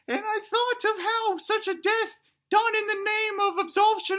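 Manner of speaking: put-on very high, squeaky grandiose child; little dinosaur son. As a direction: declaim the plan in tiny-dino falsetto. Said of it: "he says".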